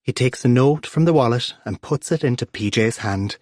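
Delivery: plain reading voice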